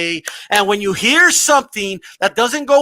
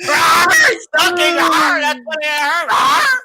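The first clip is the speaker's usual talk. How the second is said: hammed-up frightened yelps, much higher and louder; mock scared cries; 4 semitones higher, 3 dB louder, slower than usual. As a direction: shriek like a scared man, overdone for laughs. Mocking a scared opponent.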